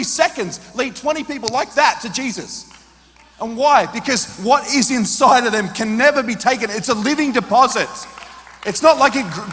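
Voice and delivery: loud, high-pitched preaching; fiery pulpit delivery; preaching at full force. Real, not acted. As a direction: build and drive each phrase.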